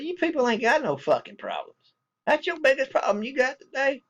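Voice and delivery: high, heated scolding; angry rant. Retold or imitated. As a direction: tear into them for complaining over nothing. Scolding petty coupon arguers.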